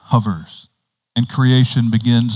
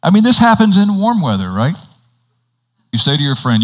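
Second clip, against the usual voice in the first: ordinary delivery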